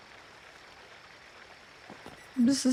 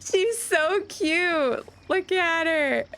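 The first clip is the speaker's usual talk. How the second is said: cooing at pet; 7 semitones higher, much slower than usual. Real, not acted. Gushing over her pet in the middle, high slow coo.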